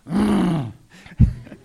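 a loud burst of real laughter; short laugh. Real, not acted. Laughs mid sermon.

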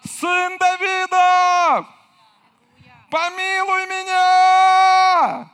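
long, high, loud drawn-out cry. Acted. Blind man calls out to Jesus, a high loud pleading cry. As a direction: call across the crowd with all you've got.

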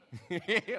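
amused laugh, high and bursting out; loud, spontaneous chuckle; real chuckle. Real, not acted. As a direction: laugh out loud at your own point. Chuckles at his own remark.

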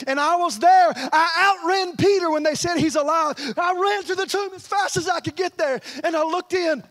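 high, rushing-forward eyewitness account; preacher acting John, pitch lifted; excited testimony. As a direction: tell it like a thrilled witness. Acts John's excited testimony.

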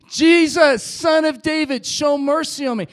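very loud, high desperate shout; full scream. Acted. Blind beggar refusing to be silenced by crowd, all-out scream. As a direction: shout it with everything you have. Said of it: "screamed out even louder".

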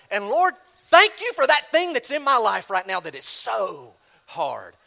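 loud, high prayer that drops on HARD; exasperated mock prayer. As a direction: push out the thanks through frustration. Acted exasperated prayer about hardship.

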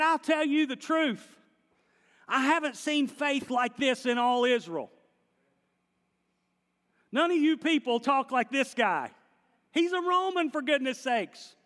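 high, loud astonished proclamation; Jesus raising his voice. Acted. Preacher voicing Jesus praising the Roman's faith, loud and high. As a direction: let Jesus marvel out loud to everyone. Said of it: "he raised his voice".

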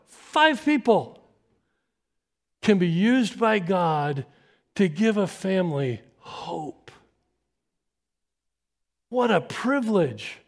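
slow, emphatic, lifted; sermon climax. Real, not acted. Preacher driving home the point, slow and emphatic. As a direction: land the point slowly for the congregation.

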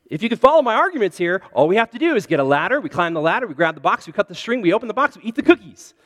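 quick, loud run of steps; eager arguing. Acted. Toad talking Frog round to the cookies, rapid eager arguing. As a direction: rattle off the plan persuasively.

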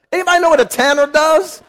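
ordinary delivery

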